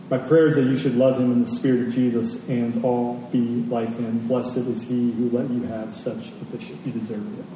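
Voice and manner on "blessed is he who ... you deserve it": ordinary talk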